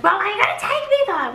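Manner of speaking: high quick challenge; high quick skeptical question; high, fast, suspicious. Retold or imitated. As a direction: demand a promise before getting in. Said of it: "I was like".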